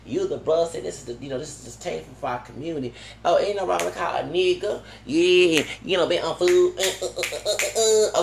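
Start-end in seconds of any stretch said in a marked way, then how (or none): 3.2-8.2 higher, louder mocking put-on voice. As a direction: mimic her loudly, ending in repeated grunts. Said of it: "that's how that Italian American one was".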